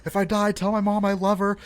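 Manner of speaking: high, loud, rushed-sounding panicked pleading, imitated; mock freaking out. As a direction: act out a kid convinced he's doomed. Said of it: "and I'm freaking out".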